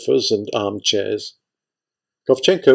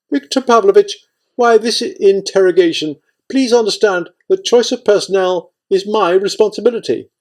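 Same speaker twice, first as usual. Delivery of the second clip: high, louder aggrieved protest; indignant protest; 10 semitones higher, 4 dB louder than usual. A narrator's reading. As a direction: protest at being questioned, pitched high. Kovchenko pleads against interrogation.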